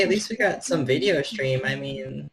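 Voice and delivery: ordinary delivery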